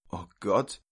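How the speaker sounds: hushed, aghast exclamation; horrified whisper. Acted. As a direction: utter it under your breath in shock. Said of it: "flüsterte die Tante entsetzt". (entsetzt geflüstert)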